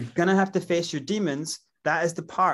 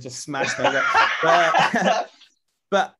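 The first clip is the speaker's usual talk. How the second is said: laughing; 6 dB louder, slower than usual. Real, not acted. Laughs mid-answer.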